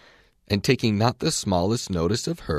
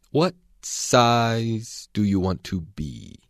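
slow languid caterpillar; 2 semitones higher, much slower than usual. A narrator's reading.

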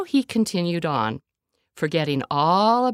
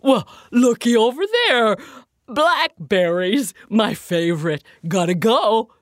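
louder, eager bear voice; excited bear; 4 semitones higher, 6 dB louder than usual. A narrator's reading. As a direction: bear delighted by blackberries. Bear spots berries.

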